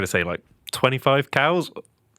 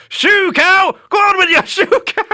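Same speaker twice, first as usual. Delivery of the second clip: very high, loud cow-shooing yell; full shout; 9 dB louder than usual. Retold or imitated. Linda charging the cows at the gate, imitated full shout. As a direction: yell wildly to scatter the herd. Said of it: "lift my arms up and shout".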